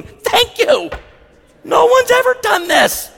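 high, tearful thank-you voice; weepy grateful Karen. Retold or imitated. Karen tearfully thanking the pastor, high and weepy. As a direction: gush through happy tears.

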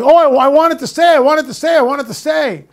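high, eager pleading in a rush of repeats; excited. Retold or imitated. Host imitating the witness wanting it to stay, excited. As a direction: imitate a thrilled witness, pitched well up. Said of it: "She's really excited about it".

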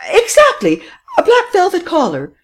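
high, loud triumphant exclamation. Acted. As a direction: crow it out in triumph. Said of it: "exclaimed Madame Renaud triumphantly".

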